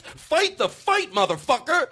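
high, worked-up mock outrage; sarcastic egging-on. Comedian voicing hardliners urging a fight, high sarcastic rant. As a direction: rant it high, as a zealot demanding no backing down. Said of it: "I'm saying it sarcastically".

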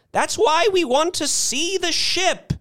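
put-on very high, strident old-lady voice; indignant insistence. A narrator's reading. Old woman demanding the ship from Mister Thurkle, insistent.

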